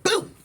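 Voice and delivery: high-pitched "boom" sound effect; imitated kick boom. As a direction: punch out a cartoon impact.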